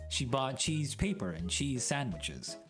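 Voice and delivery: ordinary delivery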